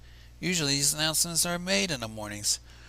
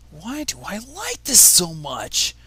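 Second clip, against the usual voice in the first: slow, louder, rattled disbelief; alarmed pony voice; 4 semitones higher, 6 dB louder, much slower than usual. Acted. Barry shaken by loving the hay, slow and alarmed. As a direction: drag it out like he can't believe his own taste. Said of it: "shaking in his seat".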